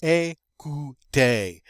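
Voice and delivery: "Écouter" slowly split, ay-koo-TAY; syllable-by-syllable demo. Shown as a demonstration. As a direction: sound out "Écouter" piece by piece. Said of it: "There we have the E with".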